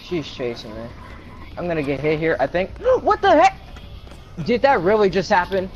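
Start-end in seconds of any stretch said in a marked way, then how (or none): 2.8-3.6 high startled gasp and exclaim; gasp, then blurt it out; shocked gasp. Real, not acted. Sudden camera glitch.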